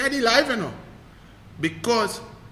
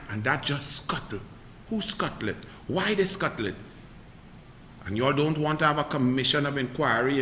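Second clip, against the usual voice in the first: much softer, lower, bitter and pointed; sudden hush; 6 semitones lower, 11 dB quieter than usual. Real, not acted. Commentator quietly accusing the government over deaths, soft and bitter. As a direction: drop the voice to hammer it home.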